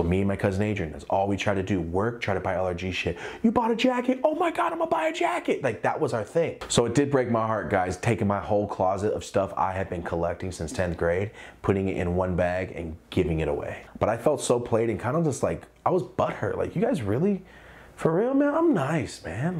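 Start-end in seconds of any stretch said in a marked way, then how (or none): 3.4-5.6 much higher, gushing with delight; high excited fan gushing about a new jacket; excited teen. Retold or imitated. 16.7-19.6 much higher, whiny and wounded; high wounded whine asking for real; hurt plea. Retold or imitated.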